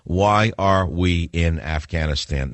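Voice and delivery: slow, deliberate question, word by word; spelled-out graffiti. Pretend. Host pretends to write graffiti on a wall, slow and deliberate. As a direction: lay each word out separately, slowly. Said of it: "Make believe I'm writing graffiti".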